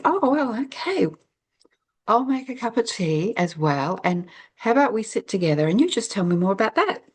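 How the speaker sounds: put-on a kind older woman soothing a tired girl; kind motherly voice. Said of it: "she still very kindly just said".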